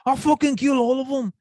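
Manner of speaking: high, hammed-up Scouse fighter bragging; a thick Liverpool accent, overdone cocky boast; mock Liverpool brag. Host imitating a Liverpool fighter's mic boast, lightly Scouse and high. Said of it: "his accent's so thick".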